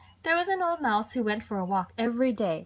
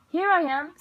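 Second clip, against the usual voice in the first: high, loud windy voice; north wind; 8 semitones higher, 6 dB louder than usual. A narrator's reading. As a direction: boom in as the wind arriving. North wind answers the mouse.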